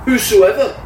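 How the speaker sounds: "whosoever" said high and loud, rising; stress on whosoever. Shown as a demonstration. Preacher showing Paul's emphasis, lifting whosoever. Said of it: "the emphasis seems to be in the word".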